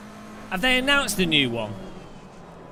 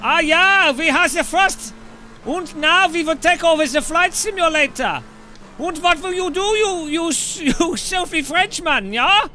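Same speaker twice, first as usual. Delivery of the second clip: gloating cartoon German accent, higher pitch; mock German accent; 3 semitones higher than usual. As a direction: lord it over the French in a jokey German accent. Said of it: "And the Germans will be like".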